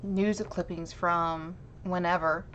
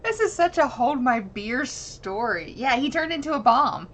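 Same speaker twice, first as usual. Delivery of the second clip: excited disbelief; 6 semitones higher, 6 dB louder than usual. Real, not acted. Reacting to exploding man story.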